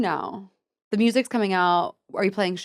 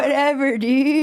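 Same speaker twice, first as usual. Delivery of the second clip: bro voice; 5 semitones higher, slower than usual. Acted. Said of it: "sometimes I've been doing this voice".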